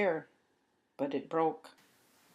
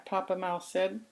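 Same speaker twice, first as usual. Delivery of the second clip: ordinary delivery, nothing marked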